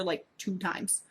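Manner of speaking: ordinary talk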